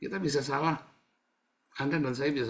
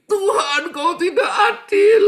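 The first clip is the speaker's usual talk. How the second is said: loud, very high wailing protest; hammed-up wail at God, drawn out; mock crying complaint; 14 dB louder, slower than usual. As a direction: whine it at full volume like a spoilt crybaby. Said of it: "kamu nangis katanya Tuhan ndak adil".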